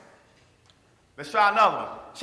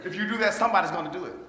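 ordinary talk, nothing marked

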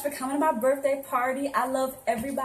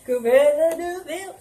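slow, louder villain drawl; Cruella voice; 4 semitones higher, 6 dB louder, slower than usual. Acted. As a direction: ham up the cartoon villainess. Performing her Cruella costume.

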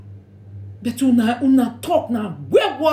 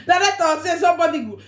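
ordinary talk

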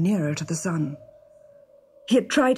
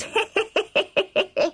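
high-pitched staccato dwarf cackle; villainous snigger. A narrator's reading. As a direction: snigger with spiteful glee. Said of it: "sniggered the dwarf".

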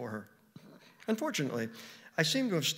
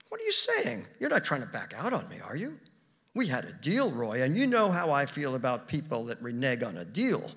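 accusing; 2 semitones higher, 4 dB louder than usual. Acted. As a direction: pitch leaping up, then settling into a warning. Acted wife accuses husband.

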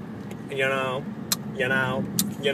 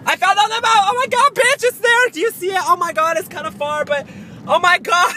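much higher, louder excited squealing; thrilled squeal; 13 semitones higher, 7 dB louder than usual. Real, not acted. Vlogger spotting something in the distance, squealing with excitement. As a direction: squeal it like you just spotted the prize.

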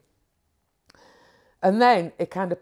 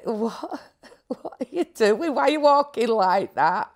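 laughing, higher questions; laughing disbelief; 4 semitones higher than usual. Retold or imitated. Retelling asking the little greys why they walk that way, laughing. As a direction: laugh through a baffled question. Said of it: "And I'm like".

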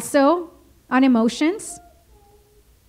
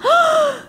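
sharp high gasp of offense; shocked gasp. Acted. Offended listener reacting to being told to calm down, sharp high gasp. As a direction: gasp as if insulted.

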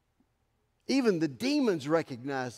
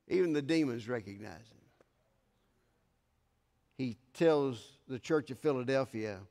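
normal talking voice

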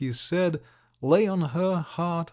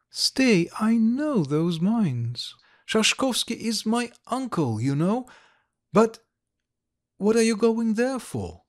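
put-on higher, startled young lady; Ilovaisky, surprised; 6 semitones higher than usual. Ilovaisky to Likharev, a higher surprised voice. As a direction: catch him up in surprise, higher.

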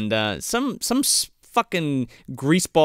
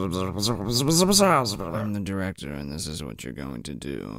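very slow, low, hushed murmur; drawn-out, deep, softly menacing imitation; imitated director's drawl; 7 semitones lower, 5 dB quieter than usual. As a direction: play the director purring orders at underlings. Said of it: "he talks like this to his underlings".

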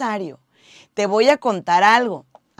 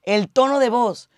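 normal talking voice

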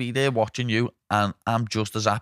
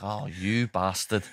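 slow and softer, drawn-out groan of disbelief; slowed, softer half-joking curse; mock dismay; 4 semitones lower, 5 dB quieter, much slower than usual. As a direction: let it sink out slowly, mock defeated. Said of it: "I was like".

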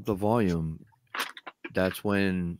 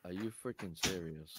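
faint voice off the mic; distant, barely picked up; far from mic; 16 dB quieter than usual. Real, not acted. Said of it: "you're quiet".

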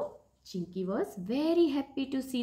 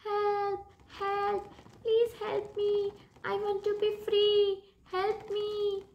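mock screaming bird; 10 semitones higher than usual. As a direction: plead in a high little bird voice. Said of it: "she started screaming".

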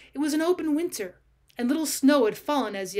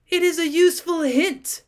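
louder, higher, biting reply; fierce retort; 5 semitones higher, 6 dB louder than usual. Acted. Ulrich answering his trapped enemy Georg, fierce and raised. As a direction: spit the words back at your enemy. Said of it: "said Ulrich fiercely".

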